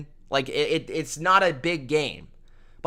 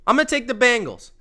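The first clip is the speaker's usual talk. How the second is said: higher, quicker, punched-up repeat; emphatic repeat; 5 semitones higher, faster than usual. Real, not acted. Picking the Bengals to viewers, emphatic repeat. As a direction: say it again with more conviction. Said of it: "repeated pick, louder".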